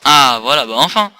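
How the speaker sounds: loud, high burst of relief; relieved exclamation. Real, not acted. A frustrated gamer finally finds the strawberry, loud relieved cry. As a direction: let it out like a long search just paid off.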